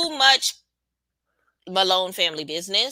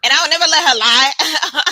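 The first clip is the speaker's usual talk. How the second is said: high, loud, firm retort; quoting Marsh; 8 semitones higher, 9 dB louder than usual. Retold or imitated. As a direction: stand your ground out loud. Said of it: "Marsh was just like".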